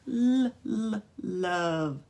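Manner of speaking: held "l", twice, before "love"; beginning sound isolated. Shown as a demonstration. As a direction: start high on separate L sounds, settle into "love". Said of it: "what sound do you hear at the beginning".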